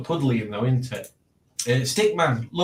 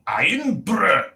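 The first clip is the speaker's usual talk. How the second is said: strained higher Scottish "Iron Brew"; Scottish accent; 7 semitones higher, 3 dB louder than usual. Shown as a demonstration. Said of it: "Does that sound better?".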